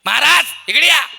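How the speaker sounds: loud high call of a wife. Retold or imitated. Wife calls her husband from inside, loud. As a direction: shout for him to come here. Wife calling him over.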